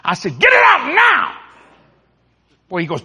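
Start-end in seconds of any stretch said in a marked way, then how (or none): 0.4-1.4 high, drawn-out furious yell; screaming. Acted. Driver ordering officer before onlookers, screaming. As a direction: scream the order slowly at full force. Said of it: "I'm screaming at this man".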